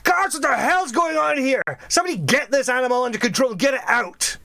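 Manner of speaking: high, agitated shout to the guards. Acted. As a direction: call out orders over the chaos. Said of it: "he just shouts".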